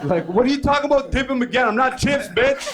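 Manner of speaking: loud, high-pitched mock outrage; indignant protest. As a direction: protest loudly at an absurd idea. Acted indignant protest to his mom.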